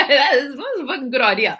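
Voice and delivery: high laughing delivery. Real, not acted. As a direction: laugh through the words. Laughs at her own joke.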